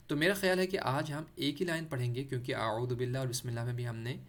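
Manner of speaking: ordinary talk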